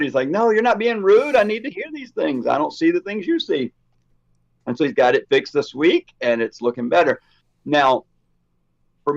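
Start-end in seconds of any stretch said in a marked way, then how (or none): 0.3-3.7 imitated reassurance. Eddie's retold reassurance.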